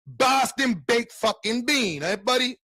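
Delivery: high, slow mocking Boston accent. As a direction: taunt a Boston buddy. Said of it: "don't like no fucking Boston".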